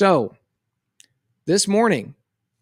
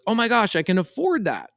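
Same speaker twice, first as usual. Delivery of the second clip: higher-pitched mock delight; excited child; 7 semitones higher than usual. Daughter sees she can afford the toy, excited imitation. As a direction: imitate a kid's thrilled discovery.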